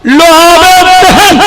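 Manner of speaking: high, slow, ringing repeat; exalted proclamation. Real, not acted. Preacher answering his question to the crowd, exalted cry. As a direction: draw the answer out high and long.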